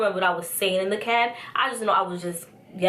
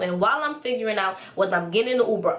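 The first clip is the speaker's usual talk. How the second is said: ordinary talk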